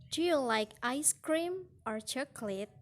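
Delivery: up on "ice cream", down on "chocolate"; rise-fall choice question. Shown as a demonstration. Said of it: "rise-fall intonation in choices".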